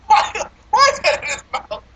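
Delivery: very high, squealing laughing question; helpless laughter. Real, not acted. Reviewer repeating the cigar question, helpless laughter.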